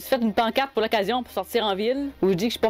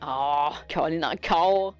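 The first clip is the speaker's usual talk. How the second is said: exasperated groan; 5 semitones lower, much slower than usual. Real, not acted. Fed up with the nickname.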